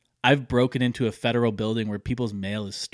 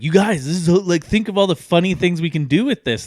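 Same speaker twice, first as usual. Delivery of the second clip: excited; 7 semitones higher, 6 dB louder than usual. Retold or imitated. Drunk pitch to unimpressed friends.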